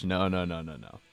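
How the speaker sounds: normal talking voice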